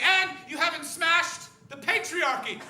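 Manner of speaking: high, loud, slow accusation; accusing woman. Retold or imitated. Angry woman accusing the comic, a high loud mock accusation. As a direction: spit out the charge. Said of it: "followed up by saying".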